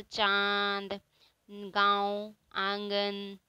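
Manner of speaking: चांद, गांव, आंगन with long nasalised vowels. Shown as a demonstration. Teacher showing sound from nose and throat, nasalised vowels. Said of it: "इसमें नाक और गला दोनों से इसका उच्चारण होता है".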